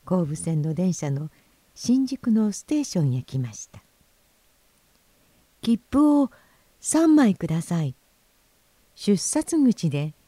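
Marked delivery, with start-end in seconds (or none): 5.6-7.9 raised storybook voice, a little louder; loud request. Acted. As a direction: call out your order at the ticket window. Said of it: "赤が大きい声で言いますと".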